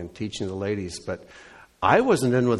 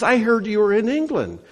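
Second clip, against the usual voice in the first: high-pitched, louder voice of an eager lady; 11 semitones higher, 5 dB louder than usual. Retold or imitated. Lady emailing the pastor from England, high and eager. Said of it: "She says".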